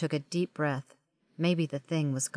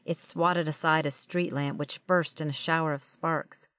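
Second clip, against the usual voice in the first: usual speaking voice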